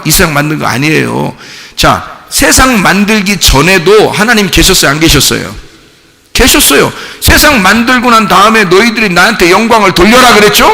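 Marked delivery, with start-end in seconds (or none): 2.3-10.7 high, loud sermon delivery building to a shout; full-force preaching. Real, not acted. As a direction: drive the point home to the congregation, ever louder.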